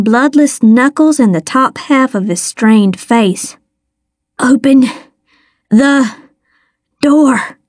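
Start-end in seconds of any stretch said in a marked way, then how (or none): 4.4-7.6 breathless, words split by gasps; a hint of panting, pushed out word by word; panted. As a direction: gasp it out while hanging on. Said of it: "he panted".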